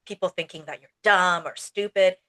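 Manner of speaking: normal talking voice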